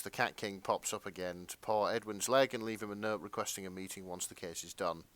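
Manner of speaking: ordinary talk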